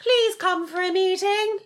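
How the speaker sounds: high, slow mock royal voice; stretched, high-pitched monarch caricature; the Queen. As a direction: summon them in a drawn-out regal falsetto. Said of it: "I'm really good at doing the Queen".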